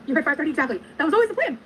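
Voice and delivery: high sing-song fake innocence; mock innocent. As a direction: act like it was the plan all along. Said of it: "Well, just kidding".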